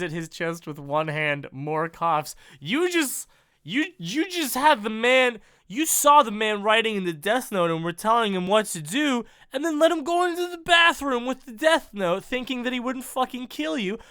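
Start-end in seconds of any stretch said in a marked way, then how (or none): 2.6-14.0 exasperated rant. Real, not acted.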